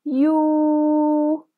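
يو stretched very slowly for four counts; teacher holding the long waw vowel. Shown as a demonstration. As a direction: hold يو long with rounded lips. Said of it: "وهنطول فيه أربع عدات".

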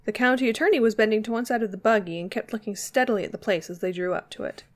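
usual speaking voice

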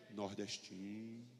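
slow, soft and low, dry punchline; deadpan verdict. Real, not acted. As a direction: drop the verdict flatly.

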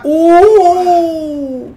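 sudden high loud whoop; excited yelp. Real, not acted. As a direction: blurt out a startled whoop. Hit lands in match.

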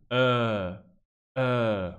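"Eh" drawn out, low; long schwa. Shown as a demonstration. Teacher contrasts long with short schwa, drawn-out eh. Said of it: "pero largo".

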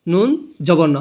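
usual speaking voice

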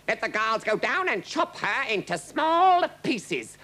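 high, forceful royal order; roaring king. A narrator's reading. King ordering Rosalba's execution, roaring. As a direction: command the guards in a rage. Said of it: "roared the king".

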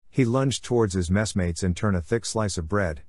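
plain reading voice

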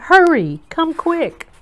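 high, urgent plea for help; frightened cry. A narrator's reading. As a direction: call them to come in a fright. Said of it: "she cried".

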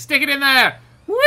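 high, loud urging; excited yelled instruction; eager command. Real, not acted. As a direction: shout it in gleefully. Urges bus into the pile.

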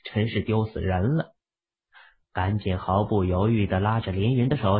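ordinary delivery

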